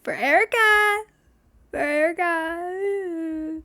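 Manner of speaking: high, loud call of the name, slowing. Retold or imitated. Barista calling her coffee for Erica, loud call. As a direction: sing out the name across the counter.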